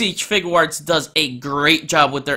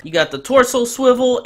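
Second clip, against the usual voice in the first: ordinary talk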